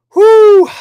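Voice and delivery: big high, loud exhale of relief; loud whew. Real, not acted. Host catching his breath after ranting, loud high whew. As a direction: blow out the tension with a loud whew. Blowing off steam after rant.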